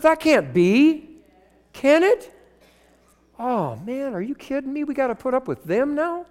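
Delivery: hushed "wait, wait" rising into high, indignant disbelief; mock shocked church member. Preacher mocking a member who thinks only Adventists are God's people, indignant disbelief. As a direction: play the scandalised member who can't believe it.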